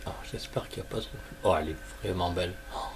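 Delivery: fast anxious whisper. Real, not acted. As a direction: whisper the worry quickly. Worried while inspecting card.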